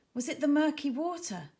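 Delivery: plain reading voice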